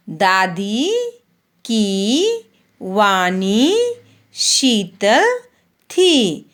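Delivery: slow, sing-song primer reading, long vowels stretched; reading to children. Acted. Teacher reads the Hindi primer to young pupils, slow and sing-song. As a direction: read each word slowly for the class to repeat.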